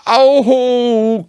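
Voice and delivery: high squeaky cry of oh; a startled squeaky oh; Piglet's cry. Acted. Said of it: "cried Piglet".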